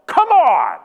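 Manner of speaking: high, loud mock-outraged outburst; exasperated yell. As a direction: yell it as if it were a curse. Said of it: "and I yell".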